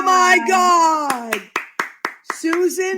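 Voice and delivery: high, loud, very slow delighted greeting; host thrilled to see her guests appear; excited. Real, not acted. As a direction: stretch it out in glad surprise. Guests pop onto screen.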